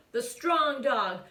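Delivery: ordinary delivery, nothing marked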